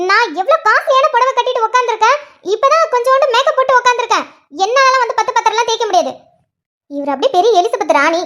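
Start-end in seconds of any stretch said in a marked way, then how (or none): 0.1-6.1 higher, haughty whiny protest; pampered mother refusing chores; pampered refusal. Acted. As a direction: act the spoiled queen.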